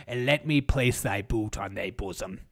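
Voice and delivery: dark lord. Pretend. Mock regal decree continues.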